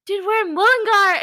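high, loud excited yell; retold yelling. Friend alerting fellow fan on a bus, retold excited yell. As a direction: imitate a friend yelling the news. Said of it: "started yelling at me".

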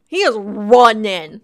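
loud, slow, "is" punched hard; He "is" running, emphatic; stress on is. Shown as a demonstration. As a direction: hit "is" hard.

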